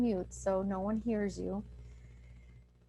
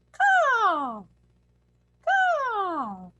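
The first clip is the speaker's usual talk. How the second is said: high, loud "Caw" rising each time, throat open; demo caw sounds; 11 semitones higher, 7 dB louder than usual. Shown as a demonstration. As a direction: call out "caw" three times, climbing higher.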